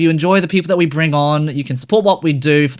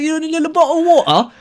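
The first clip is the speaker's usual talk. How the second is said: much higher, fast British voice; mock British accent; 10 semitones higher, faster than usual. Host imitating British speakers saying bottle of water. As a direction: chirp it like a posh Brit. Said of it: "the British one".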